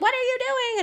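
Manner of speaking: high, loud squeaky imitation of the team; mock baffled team. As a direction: play the stunned staff, voice way up. Team reacting to her antics.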